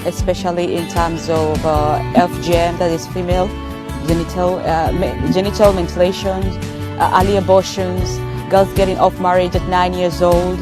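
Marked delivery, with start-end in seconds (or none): none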